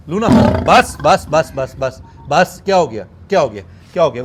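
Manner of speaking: loud soothing to an angry lioness; firm calming shout. Real, not acted. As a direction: hush her down loudly but kindly.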